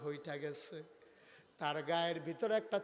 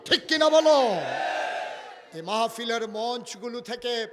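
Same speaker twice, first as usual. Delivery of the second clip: raised rally voice building to a roaring shout; full-force preaching; 12 semitones higher, 23 dB louder than usual. Real, not acted. Preacher rallying the people of Gauripur, full-force shouting. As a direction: thunder at the crowd, louder with each phrase.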